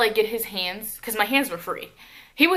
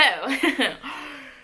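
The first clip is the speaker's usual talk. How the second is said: higher short laugh; real laugh; 5 semitones higher than usual. Real, not acted. Storyteller about to get to the drama, nervous-sounding short laugh. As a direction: laugh before the juicy part.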